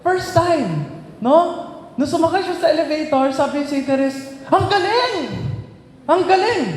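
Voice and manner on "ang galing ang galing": high, delighted cry of wonder; overjoyed. Retold or imitated. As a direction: marvel like a child on her first elevator ride. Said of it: "Sa sobrang tuwa".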